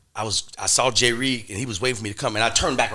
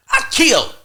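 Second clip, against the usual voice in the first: "I killed" repeated much higher and louder, near a cry; escalated insistence; 9 dB louder than usual. Retold or imitated. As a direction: hit "I killed" at the peak of the voice.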